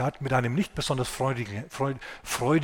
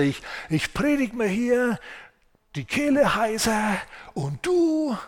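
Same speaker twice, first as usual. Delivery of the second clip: shrill nagging rant; 8 semitones higher than usual. A narrator's reading. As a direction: whine and scold at God like a sulking prophet. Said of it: "Und Jona keift und zetert".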